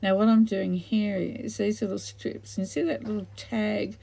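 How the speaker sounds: ordinary delivery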